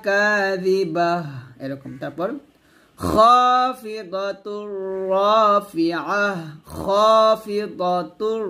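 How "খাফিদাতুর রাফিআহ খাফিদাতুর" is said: খাফিদাতুর with a short খ, slow; drawn-out খাফিদাতুর; correct pronunciation demo. Shown as a demonstration. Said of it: "এখানে খা বলবেন না". (খাফিদাতুর ধীরে)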